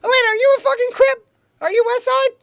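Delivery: high, loud mock outrage; hammed-up alarm at the news; mock shock. Mock shock at gangbanger partner.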